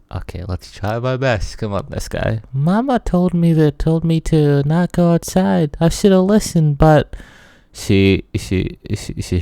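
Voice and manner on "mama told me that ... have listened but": put-on high, louder child's voice near tears; little girl voice. Player voicing the girl who should have listened to Mama, high and tearful. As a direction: wail it like a lost child.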